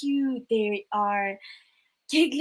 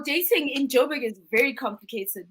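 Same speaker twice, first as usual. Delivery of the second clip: ordinary talk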